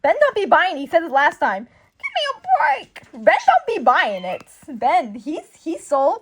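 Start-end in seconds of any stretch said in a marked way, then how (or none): 1.6-3.0 exasperated. Acted. Acting Ben's disbelief.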